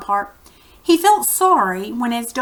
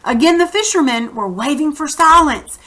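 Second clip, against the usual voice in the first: reading a chapter aloud to the class; teacher narrating a children's novel; story narration; 5 dB louder than usual. A narrator's reading. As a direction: tell the story to the kids, steady and clear.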